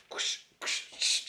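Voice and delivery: breathy whooshing noises for each move; soft whoosh whoosh whoosh; whoosh sounds. Retold or imitated. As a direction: swoosh along with her arm swings. Mimics dancer's swooshing moves.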